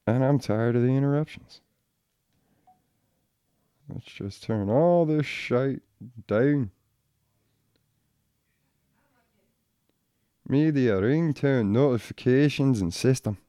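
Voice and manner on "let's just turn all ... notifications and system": slow, soft put-on Irish accent; quiet playful Irish accent. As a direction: drift into a lilting Irish accent while muttering. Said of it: "I went all Irish there".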